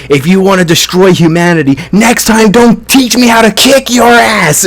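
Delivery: high mock-furious taunt, building in pitch; retold scream. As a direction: imitate a triumphant yell without full volume. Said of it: "you know, screaming in his head".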